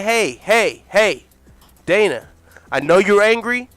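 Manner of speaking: firm pushback. Acted. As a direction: warn him not to come at you.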